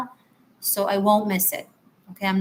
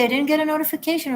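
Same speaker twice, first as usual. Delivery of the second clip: higher, rushed explanation; flustered apology; 7 semitones higher, much faster than usual. Real, not acted. Reader apologizes to the viewer for missing her, fast flustered. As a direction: blurt it out fast, embarrassed.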